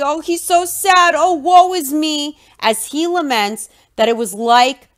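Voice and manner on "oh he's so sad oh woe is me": hammed-up woe, pitched higher; mock pity, a sarcastic sigh; sarcastic fake sorrow; mock sad sighing. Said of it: "he's sighing sadly".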